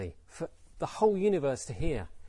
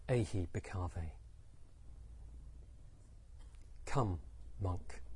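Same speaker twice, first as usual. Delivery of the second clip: low, soft invitation; quiet summons; 7 semitones lower, 11 dB quieter, slower than usual. Acted. Buddha ordaining Angulimala, quiet summons. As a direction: receive him softly, leaving space between words.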